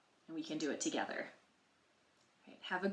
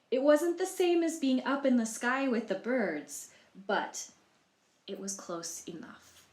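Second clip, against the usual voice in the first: story narration; 3 semitones higher than usual. A narrator's reading. Librarian reading Humpty Dumpty's story to children, storybook voice. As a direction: tell it like a storybook to young listeners.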